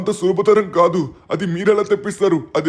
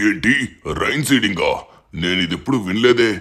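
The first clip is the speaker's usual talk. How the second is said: put-on much lower, heavy-hearted old official's voice; weary president; 9 semitones lower than usual. Troubled president tells the boy of drought, low and weary. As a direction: sink the voice low and sigh out the worries.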